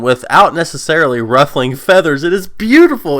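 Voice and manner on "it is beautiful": higher and louder, raving; excited gush. Real, not acted. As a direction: burst out with admiration. Gushing over Yang's speech.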